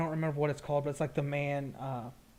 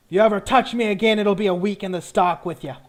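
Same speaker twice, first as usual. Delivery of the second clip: loud, raised-pitch threat; belted out; 6 semitones higher, 11 dB louder than usual. Acted. Lieutenant threatening whoever touched him, belted out. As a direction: belt it out like an angry officer. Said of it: "the lieutenant belts out".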